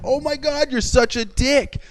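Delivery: high-pitched, drawn-out incredulity; retold amazed jeer, way up in pitch; shocked disbelief. Telling off the driver at the pumps, shocked. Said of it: "I was so shocked that I just went".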